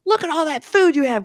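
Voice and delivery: high, loud mock accusation; imitated yelling. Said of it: "he's yelling at him".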